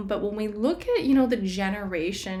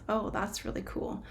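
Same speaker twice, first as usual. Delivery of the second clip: whispered aside; 8 dB quieter than usual. Real, not acted. Awed aside during crystal gazing.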